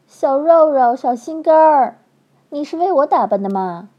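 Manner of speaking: high, loud cooing endearments; woman rushing down the stairs, gushing, high and loud; gushing coquette. A narrator's reading. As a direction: coo over him like a smitten flirt. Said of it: "嘴里一连串的叫".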